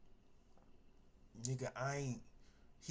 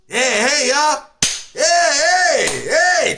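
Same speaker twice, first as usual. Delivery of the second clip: higher, louder friendly hollering; cheerful greeting; 8 semitones higher, 4 dB louder, slower than usual. Retold or imitated. Convict happily greeting bailiffs, higher and louder. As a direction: wave hello to everyone, overjoyed.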